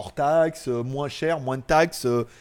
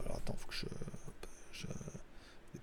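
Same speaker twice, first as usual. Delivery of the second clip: whispered aside; 18 dB quieter than usual. Real, not acted. Aside while fiddling with phone.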